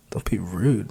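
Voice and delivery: soft, breathy whisper of the phrase; whispered aside to herself. A narrator's reading. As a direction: murmur it to yourself, puzzled. Repeating the words to herself.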